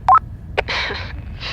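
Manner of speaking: short breathy laugh. Acted. Amused at reply.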